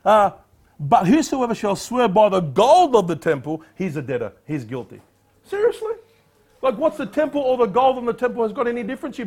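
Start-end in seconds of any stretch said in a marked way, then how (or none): 0.8-5.0 scripture reading. Acted. Preacher reading verse to congregation, declaiming. 5.4-5.9 much higher, disbelieving; can you believe this; incredulous. Real, not acted. Preacher scoffing at the Pharisees' loophole, incredulous. Reacts to the verse.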